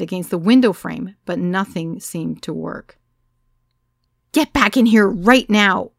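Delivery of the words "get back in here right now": loud scream to get their attention; shouting over a rowdy party. A narrator's reading. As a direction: yell them back into the room. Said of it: "I screamed".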